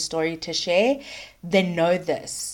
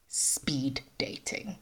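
slow hushed whisper; 5 semitones lower, 13 dB quieter, slower than usual. Acted. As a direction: breathe it like a secret. Teasing reveal of the topic.

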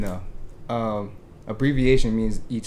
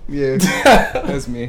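smug confirmation; 6 dB louder, much slower than usual. Pretend. Owning the persona.

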